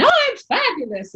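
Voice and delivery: very high, gushing exclamation; hammed-up, insincere enthusiasm; fake cheer. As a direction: overdo the delight, high and bright. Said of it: "lying to everybody and say".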